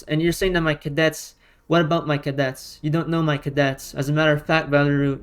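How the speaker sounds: ordinary talk, nothing marked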